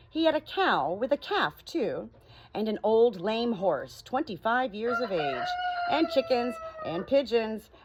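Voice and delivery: lively storybook reading, rising and falling; story narration. A narrator's reading. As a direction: read it like a bedtime story to friends. Reads story aloud.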